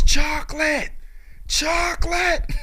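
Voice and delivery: high, loud hollered word, the second drawn out slowly; screaming salesman. Retold or imitated. The salesman chasing them down the street, high loud hollering. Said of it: "he's screaming at them about this chocolate".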